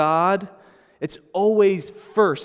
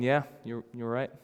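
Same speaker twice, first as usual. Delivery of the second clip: mild mock agreement; 5 semitones lower, 8 dB quieter than usual. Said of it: "silently nodding their heads, going".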